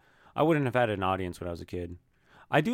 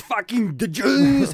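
high, slow, shouted mock dictator rant; hammed-up bellow in a muddled Austrian accent; Hitler parody; 11 semitones higher, 8 dB louder, much slower than usual. Friend parodying a dictator for laughs, slow loud mock rant. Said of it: "if Hitler was like".

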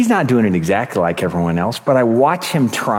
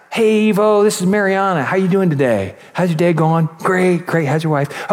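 higher-pitched, chatty American-style pleasantries; friendly phone greeting; 5 semitones higher than usual. Retold or imitated. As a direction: imitate a sociable caller making small talk. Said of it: "And, and it's not".

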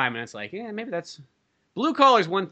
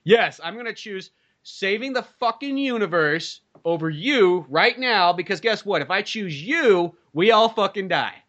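slow, emphatic sarcastic comeback; exasperated retort; 4 semitones higher, slower than usual. Acted. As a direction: spell it out slowly as if to someone unreasonable. Acted exasperated answer to girlfriend.

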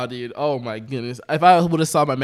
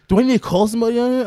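fast, higher-pitched mock angry customer; pissed customer; 6 semitones higher, faster than usual. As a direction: imitate a customer rushing out an irritated threat. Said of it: "He'd get pissed and more angry".

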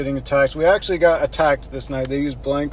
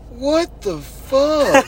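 high, very drawn-out mock bewilderment; imitated disbelief; 10 semitones higher, much slower than usual. Friend whose towel got thrown on the ground, drawn-out imitated disbelief. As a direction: stretch each word high, imitating a baffled friend. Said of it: "you're just like".

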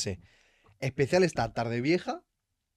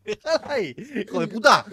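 startled cursing; 8 semitones higher, 5 dB louder than usual. Real, not acted. Reacting to the shock.

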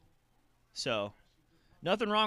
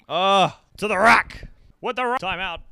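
excited play-by-play; 6 semitones higher, 12 dB louder than usual. Pretend. Fan calling the game on TV, loud announcer call.

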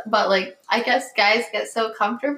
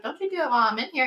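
fast warning; 3 semitones higher, 4 dB quieter, much faster than usual. Retold or imitated. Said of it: "I was like".

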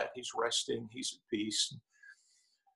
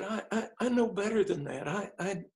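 higher-pitched, halting father imitated; retold tearful regret; 6 semitones higher than usual. Dying father correcting himself to his son, retold tearful regret. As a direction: stammer lightly, as a tearful father. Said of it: "his eyes got red".

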